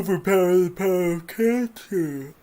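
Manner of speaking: loud dramatic episode-ending announcer; anime narrator. Acted. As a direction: hype the next-episode cliffhanger.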